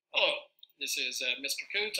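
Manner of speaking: played back thinly through the laptop speaker; a recording heard back off the machine; recorded playback. Shown as a demonstration. Teacher plays his test recording back to the class, tinny playback. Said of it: "notice that it recorded that sound there".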